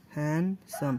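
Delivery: Handsome slowly broken in two, HAND - SOME; teacher sounding out handsome beat by beat; split into syllables. Shown as a demonstration. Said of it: "दो syllable है ना".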